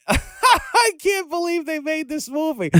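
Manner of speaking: high, drawn-out incredulous squeal through a laugh; laughing disbelief. Retold or imitated. Telling the host about watching the movie, laughing in disbelief. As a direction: retell your disbelief slowly, high and amused.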